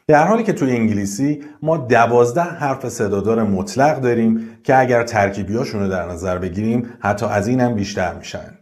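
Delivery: ordinary talk, nothing marked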